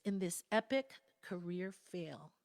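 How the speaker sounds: normal talking voice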